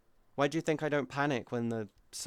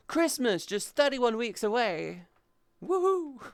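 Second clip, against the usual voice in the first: high, bright sarcastic hype, pitched up; mock cheer; 11 semitones higher, 5 dB louder than usual. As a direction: cheer it like a game show host. Mock cheer about far-off Christmas.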